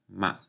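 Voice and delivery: "ma" short, at normal pitch; neutral tone. Shown as a demonstration. Teacher repeating the tones, neutral short "ma". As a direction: say "ma" briefly without accent. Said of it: "Quinto tono".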